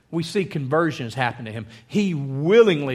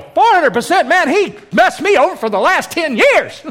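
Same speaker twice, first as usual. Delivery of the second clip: high, loud, hammed-up outcry; excited claimants; 11 semitones higher, 8 dB louder than usual. Cheated townspeople hear of four hundred percent payback, excited shouting. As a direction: shout it like someone owed a payout. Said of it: "They go".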